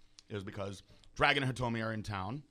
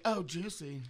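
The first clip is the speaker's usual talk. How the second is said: higher, bright mock girl voice; cartoon girl voice; 7 semitones higher, slower than usual. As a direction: chirp it like a packaging girl. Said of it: "The girl's saying".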